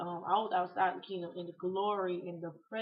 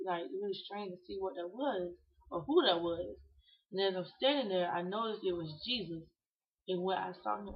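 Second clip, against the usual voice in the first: ordinary delivery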